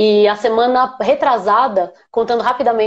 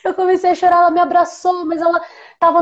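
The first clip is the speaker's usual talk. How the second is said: moved laughter; 6 semitones higher than usual. Real, not acted. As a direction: let the emotion spill out as a laugh. Moved to tears, laughing while telling. (riso emocionado, mais lento e agudo)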